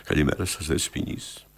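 soft, very low greeting; understated demonstration. Shown as a demonstration. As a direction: show the actor a restrained greeting, deep and hushed. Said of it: "να σας το παίξω εγώ".

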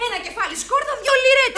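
high, loud street cry; calling out. Acted. Crying garlic for sale in the alley, loud calling out. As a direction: shout the price out to passers-by.

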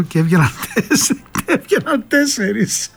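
real laughter breaking into the words; helplessly laughing through the punchline; cracking up. Real, not acted. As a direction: voice climbing high and slowing with laughter. Cracks up at own joke.

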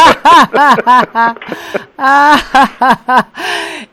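long, rolling burst of laughter; drawn-out laughing fit; hearty laugh. Real, not acted. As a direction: laugh freely at a punchline. Laughs at the story.

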